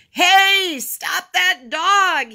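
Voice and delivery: loud, high, drawn-out shout; slow, full-voiced alarmed yell. A narrator's reading. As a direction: holler to stop a thief. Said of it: "he yelled".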